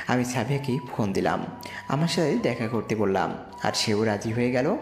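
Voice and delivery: ordinary delivery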